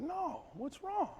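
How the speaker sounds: gentle dad. Retold or imitated. Dad gently pressing his son.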